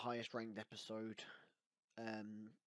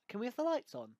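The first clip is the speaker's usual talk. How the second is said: mock Doctor voice; 13 semitones higher, 7 dB louder, faster than usual. Mocking the Doctor in car.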